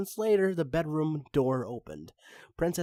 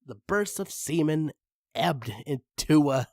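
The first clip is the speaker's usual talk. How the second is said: ordinary delivery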